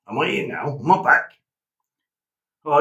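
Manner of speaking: ordinary delivery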